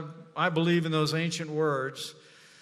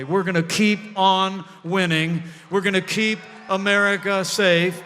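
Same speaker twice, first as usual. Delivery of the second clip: loud, high rally chant; rally speech; 6 semitones higher, 8 dB louder than usual. Real, not acted. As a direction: whip up the crowd. Rally close, shouted chants.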